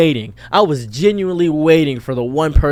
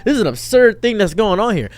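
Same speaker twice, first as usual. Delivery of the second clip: usual speaking voice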